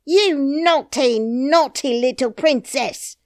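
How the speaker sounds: loud scolding nurse voice, high; crying out angrily. Acted. Nurse scolds the princess, loud and high. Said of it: "cried Lootie".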